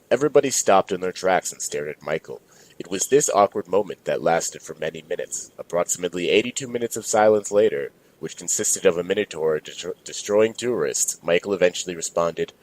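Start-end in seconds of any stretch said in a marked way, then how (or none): none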